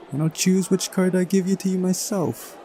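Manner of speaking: high put-on girl's voice; bossy girl character. Voicing Uliana setting her rules.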